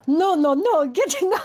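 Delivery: put-on high French-accented scolding, breaking into laughter; half-laughing French-accented refusal; angry French. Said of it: "retake your French angry".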